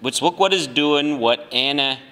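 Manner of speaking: a hint of a hammed-up Southern drawl; reading as a Southerner. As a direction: read it like a mock South Carolina senator. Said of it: "Just pretend you are a".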